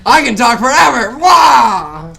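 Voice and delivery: loud, high, hammed-up yell; playful shout. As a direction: shout it like a silly outburst. Mock outburst about talking forever.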